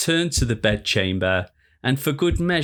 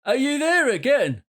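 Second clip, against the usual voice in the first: mock yell. A narrator's reading. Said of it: "Cottrell yelled".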